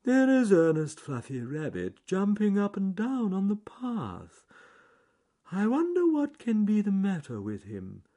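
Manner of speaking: high wondering tortoise voice, loud and measured. Acted. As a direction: wonder aloud in a high character voice. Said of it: "said John Slow Tortoise".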